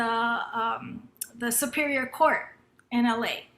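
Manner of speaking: usual speaking voice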